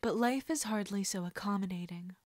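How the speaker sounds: plain reading voice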